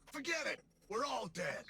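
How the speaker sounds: sudden high panicked cry. Acted. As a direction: blurt it out in panic. Panics about Xivu's power.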